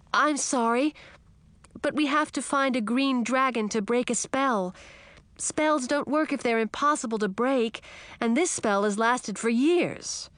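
put-on high, pleading young girl; a small frog insisting it must be true; frog princess.

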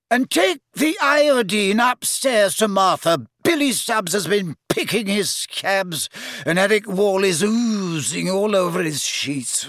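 high, slow-stretched harried grumbling; harassed matron. A narrator's reading. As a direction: complain over your shoulder, high and harassed. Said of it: "a skinny, harassed-looking woman came scurrying".

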